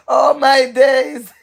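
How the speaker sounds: laughing in disbelief; laughing exclamation. Real, not acted. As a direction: drag it out while laughing. Reacting to a comment, laughing.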